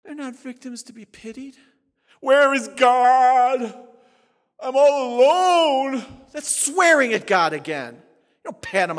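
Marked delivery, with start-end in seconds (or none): 2.2-6.3 mock lament. Mocking hopeless believer's lament.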